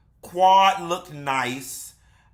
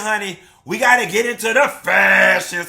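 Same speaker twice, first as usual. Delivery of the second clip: catchphrase; 3 semitones higher, 7 dB louder than usual. Acted. Signature catchphrase.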